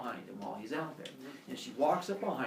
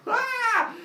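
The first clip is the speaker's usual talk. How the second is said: very high, loud shriek; mock scream of a horrified crowd; 8 dB louder than usual. Townspeople screaming again.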